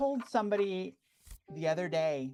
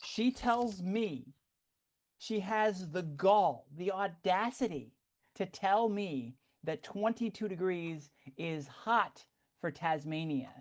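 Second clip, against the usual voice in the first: slow, drawn-out theatrical outrage; hammed-up affront, told very slowly; mock indignation; slower than usual. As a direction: weigh out each word in disbelief. Mock-indignant retelling of an insult.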